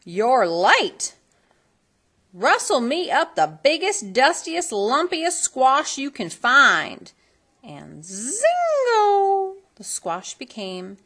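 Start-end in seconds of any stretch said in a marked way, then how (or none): 0.0-7.1 higher, louder, slow drawled orders; bossy godmother. A narrator's reading. 7.6-9.6 very high, slow, stretched exclamation; narrator's magic burst; zingo. A narrator's reading.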